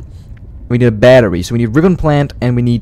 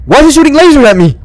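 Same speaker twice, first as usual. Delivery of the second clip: panicked yelling; 7 dB louder than usual. Real, not acted. Shot at by laser fish.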